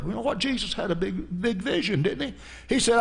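ordinary talk, nothing marked

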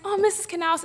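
high-pitched call for help; mildly acted high appeal; imitating choked-up appeal. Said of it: "choked up".

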